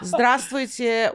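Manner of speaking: laughing Russian hello; Russian greeting. Shown as a demonstration. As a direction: say Zdravstvuyte laughing. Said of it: "say something for us".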